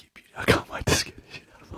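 fast excited whisper. Retold or imitated. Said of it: "And I'm like".

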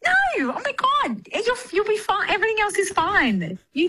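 usual speaking voice

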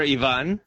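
Ivan said as ee-VAHN; preferred name pronunciation. Shown as a demonstration. Comedian correcting hosts on his name, Ivan as ee-VAHN. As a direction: stress the second syllable of Ivan, ee-VAHN. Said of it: "I prefer Ivan".

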